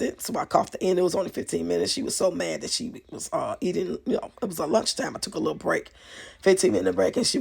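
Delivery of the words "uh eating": usual speaking voice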